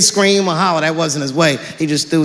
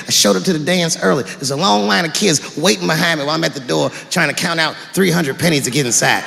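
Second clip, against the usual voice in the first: normal talking voice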